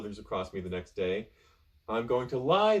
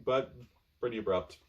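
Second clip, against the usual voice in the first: plain reading voice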